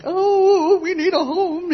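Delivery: high, loud sing-song wail; mock pleading wail. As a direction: ham up a needy plea. Mocking a plea she never made.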